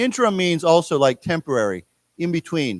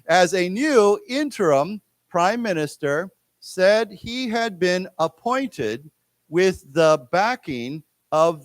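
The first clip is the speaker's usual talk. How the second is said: slow, measured article reading; reading aloud to class; slower than usual. Shown as a demonstration. Teacher reading a news article to students, slow careful read-aloud. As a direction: read slowly in short careful phrases for learners. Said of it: "read this article".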